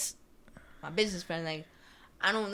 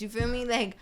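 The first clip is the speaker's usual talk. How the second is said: ordinary talk, nothing marked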